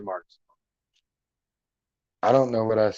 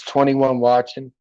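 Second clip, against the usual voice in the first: normal talking voice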